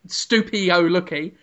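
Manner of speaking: ordinary delivery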